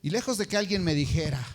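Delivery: normal talking voice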